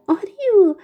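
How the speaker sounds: loud, high call across the stream; child's shout. A narrator's reading. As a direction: shout the name to greet him from afar. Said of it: "فریاد زد". (فریاد بلند و شاد کودک)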